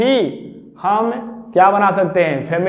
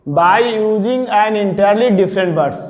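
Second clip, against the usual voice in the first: slow dictation; much slower than usual. Shown as a demonstration. Teacher dictating rule one.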